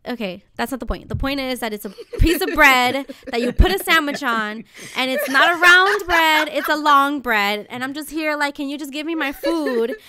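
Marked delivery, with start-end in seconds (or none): 1.1-10.0 louder, insistent, fed-up explaining; exasperated rant. Retold or imitated. Hungry customer arguing about bread with the deli guy, loud exasperation. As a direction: push each point home, getting louder.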